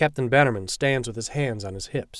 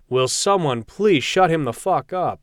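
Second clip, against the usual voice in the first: slow, loud, exasperated; exasperated shout; 4 semitones higher, 5 dB louder, slower than usual. Acted. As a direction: drag out the annoyed order. Captain irritated by screaming.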